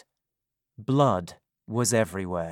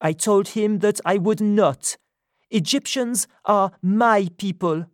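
higher, louder woman's voice, indignant; Sophie's voice; 9 semitones higher, 5 dB louder than usual. A narrator's reading. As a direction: speak up as her, indignant. Sophie defiant.

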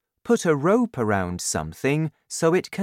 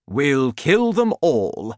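tough soldier; 3 semitones higher, 5 dB louder, slower than usual. Acted. Air force head shouts.

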